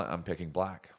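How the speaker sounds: ordinary talk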